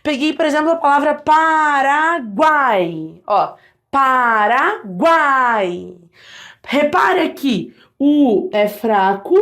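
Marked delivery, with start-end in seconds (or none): 1.1-6.0 PARAGUAI very slow, GUAI held as one gliding syllable; triphthong demo. Shown as a demonstration. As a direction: stretch PARAGUAI, sliding u-a-i together. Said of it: "tudo na mesma sílaba".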